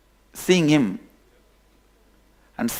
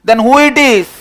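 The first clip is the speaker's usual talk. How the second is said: loud high shout; 9 semitones higher, 10 dB louder than usual. Acted. Lion shouting across to the fellow, loud. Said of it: "he shouts".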